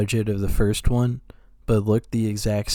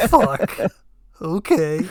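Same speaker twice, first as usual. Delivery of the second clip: mock reluctant acceptance; 5 semitones higher, 3 dB louder, slower than usual. Acted actor reluctantly giving in.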